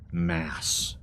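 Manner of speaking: ordinary talk, nothing marked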